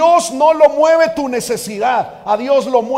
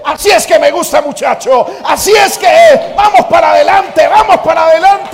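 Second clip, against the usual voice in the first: shouting, high and loud triumphant cheer; God cheering; 6 semitones higher, 9 dB louder than usual. Acted. Preacher voicing God standing up to cheer the believer on. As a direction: roar approval like a coach. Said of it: "El Señor se pone en pie y dice".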